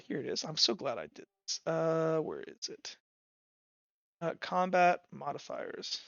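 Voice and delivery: normal talking voice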